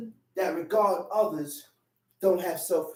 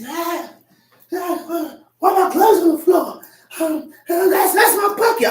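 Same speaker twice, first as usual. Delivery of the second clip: high, loud, indignant complaint; a touch of aggrieved coworker, pitched up; upset coworker; 9 semitones higher, 7 dB louder than usual. Retold or imitated. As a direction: play the annoyed guy whose bucket it was. Angry coworker voiced in story.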